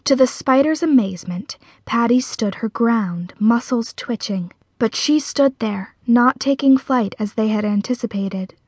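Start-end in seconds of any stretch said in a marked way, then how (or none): none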